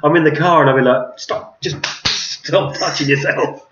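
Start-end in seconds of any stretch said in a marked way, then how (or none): 1.2-3.7 slow hushed telling-off to herself; whispered self-scolding. Retold or imitated. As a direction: breathe it out slowly, scolding yourself.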